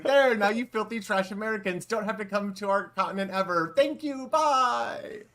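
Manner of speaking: a European comic mocking American audiences; vague European accent. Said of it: "a vague European accent".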